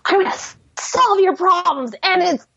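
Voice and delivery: a hint of Matt Smith's Doctor; eager hero. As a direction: bounce it like a cheerful know-it-all hero. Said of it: "Matt Smith, Doctor Who number eleven type".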